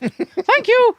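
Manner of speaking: put-on high, slow old lady voice; old woman voice. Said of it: "and she goes".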